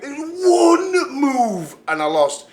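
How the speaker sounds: tearful outburst. Acted. Wrestler Nash on the mic after losing, crying shout. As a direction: wail it like the loss just hit you. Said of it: "I'm so emotional".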